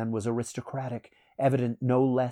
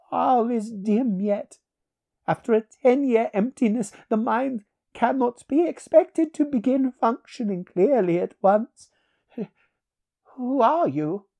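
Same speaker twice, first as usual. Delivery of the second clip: put-on high-pitched, loud old-world sorcerer voice; dazed stranger; 13 semitones higher, 7 dB louder than usual.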